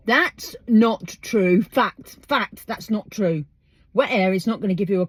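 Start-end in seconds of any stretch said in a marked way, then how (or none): 0.1-1.6 each word slow and heavy; slow, deliberate denial; emphatic. Real, not acted. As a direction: spell it out, no argument. Firmly denying a superstition.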